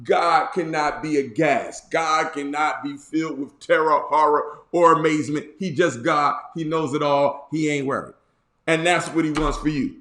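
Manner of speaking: normal talking voice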